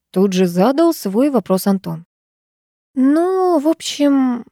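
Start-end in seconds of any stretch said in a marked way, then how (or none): 2.9-4.4 high, drawn-out hesitant mumble; hemming and hawing. A narrator's reading. Daughter evasively answers her brother's question, hemming and hawing. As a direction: stall for time, stretching the words. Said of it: "стала мямлить Аня".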